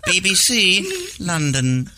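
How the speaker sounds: high, slow announcer sign-off; BBC sign-off. Retold or imitated. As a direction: stretch out the station tag. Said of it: "I love how he does that tag".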